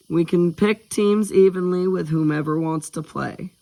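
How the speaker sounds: ordinary delivery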